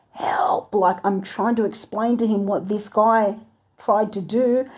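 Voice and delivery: normal talking voice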